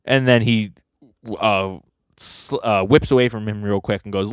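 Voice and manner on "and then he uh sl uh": usual speaking voice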